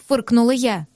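plain reading voice

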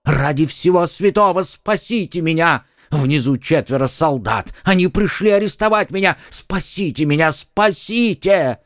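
high frantic begging; panicked pleading. Acted. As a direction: beg for your life. Terrified haberdasher begs for help. (высокая паническая мольба)

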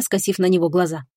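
usual speaking voice

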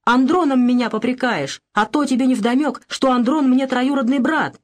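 выше и громче, возмущаясь; оправдываться в гневе; возмущённо. Acted. Мать оправдывается в ссоре. (мать возмущённо оправдывается перед дочерью)

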